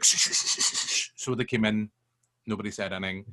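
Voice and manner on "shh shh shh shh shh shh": whispered shushing from the ushers; breathy repeated shh, no voice. Retold or imitated. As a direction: imitate ushers hushing the room in a whisper. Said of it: "they were like".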